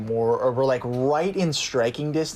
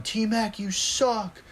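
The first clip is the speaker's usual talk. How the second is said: high-pitched mock yell, no real volume; raised-pitch retold jeer; heckling fan; 9 semitones higher, much slower than usual. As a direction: imitate a drunk fan's heckle without shouting. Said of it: "just kept yelling".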